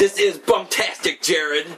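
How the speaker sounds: high, loud show intro; announcer intro. Real, not acted. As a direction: belt the show name like an announcer. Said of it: "Oh, I'm sorry".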